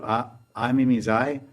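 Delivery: usual speaking voice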